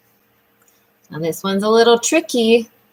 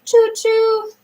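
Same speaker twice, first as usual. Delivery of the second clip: high sing-song choo-choo; train whistle; 11 semitones higher than usual. Pretend. Teacher makes a train noise for a child, playful high toot.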